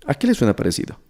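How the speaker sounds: usual speaking voice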